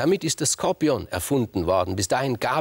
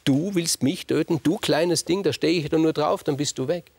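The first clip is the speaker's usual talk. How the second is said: scornful, higher and bigger, laughing it off; mocking giant; 8 semitones higher, 3 dB louder than usual. Orion mocking the little scorpion, scornful and amused. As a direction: sneer down at a tiny thing. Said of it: "Orion lacht".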